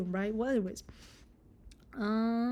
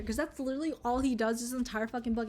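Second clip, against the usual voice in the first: ordinary talk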